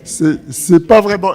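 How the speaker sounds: chuckling through the words; laughing. Real, not acted. Lecturer laughing at the joke's punchline to the audience. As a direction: laugh while you start the sentence. Teller laughing at his own joke.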